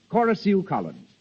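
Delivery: normal talking voice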